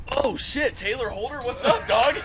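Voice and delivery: high, excited caught-out dude; surprised bro voice. Retold or imitated. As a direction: play the guy caught red-handed, cheery. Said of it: "he goes".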